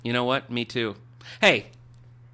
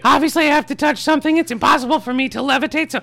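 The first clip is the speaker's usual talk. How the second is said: high excited character voice; 10 dB louder than usual. Acted.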